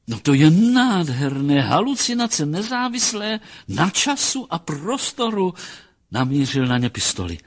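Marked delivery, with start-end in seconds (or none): 0.1-5.5 loud burst, then high-pitched rapture; high, enraptured admiration; delighted marvelling. Acted. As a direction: exclaim in acted scientific ecstasy. Psychologist enraptured by the hallucination.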